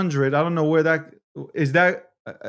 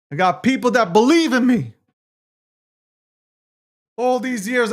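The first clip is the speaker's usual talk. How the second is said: ordinary talk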